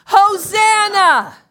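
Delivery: Hosanna drawn out, loud and high; full shout. Shown as a demonstration. Pastor leading the congregation in a shout, long loud Hosanna. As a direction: shout it from the top of your lungs. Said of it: "Again, one, two, three".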